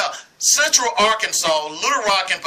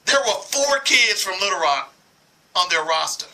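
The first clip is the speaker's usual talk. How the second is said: usual speaking voice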